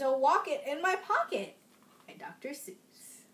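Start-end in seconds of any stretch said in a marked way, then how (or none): 2.1-3.2 soft whispered credit; hushed whisper. Acted. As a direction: whisper the author's name. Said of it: "whispered author credit".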